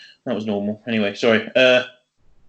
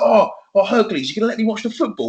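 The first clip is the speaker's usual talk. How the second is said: excited bloke; 8 semitones higher, faster than usual. Retold or imitated. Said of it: "he's like".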